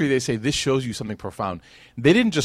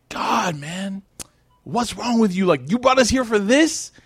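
higher, indignant retort rising to a shout; angry outburst; 8 semitones higher, slower than usual. Retold or imitated. Abu Lahab scolding the Prophet, higher and rising in anger. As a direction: snap at him in disgust. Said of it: "And he actually cursed him".

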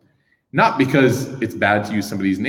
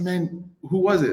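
curious; 8 semitones higher, 3 dB quieter, slower than usual. Retold or imitated. Retelling his own reply.

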